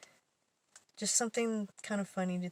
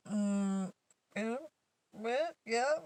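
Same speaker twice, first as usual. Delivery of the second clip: hesitant; 3 semitones higher, much slower than usual. Retold or imitated. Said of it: "she didn't know what to say".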